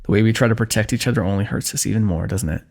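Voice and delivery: ordinary talk, nothing marked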